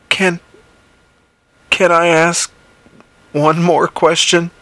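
John forcing words out. Acted. Paralysed John forces a question at the creature, halting. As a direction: squeeze each word out against paralysis. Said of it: "John forced out".

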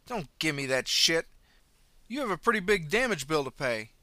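angry driver. Acted. Driver demands the runner pay damages, angry. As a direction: refuse to hear excuses.

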